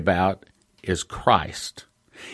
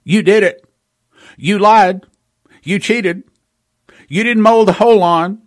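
loud, higher jabbing accusations; accusing devil; 6 semitones higher, 5 dB louder than usual. Retold or imitated. The devil blaming you for his own sins, loud and accusing. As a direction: hurl each charge like a blame. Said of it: "he points the finger at you and he says".

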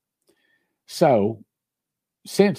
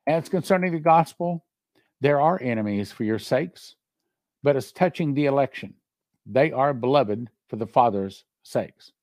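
scripture reading; 4 semitones higher than usual. Acted. Reads scripture aloud.

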